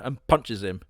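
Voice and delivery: ordinary talk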